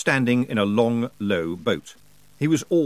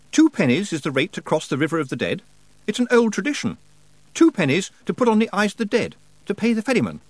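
put-on earnest high-pitched young hero; Roland, explaining; 9 semitones higher, faster than usual. Roland explains the fare to Rob, insistent. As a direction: explain the custom in a raised voice.

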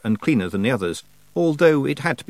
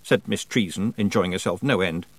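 usual speaking voice

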